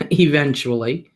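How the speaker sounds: ordinary delivery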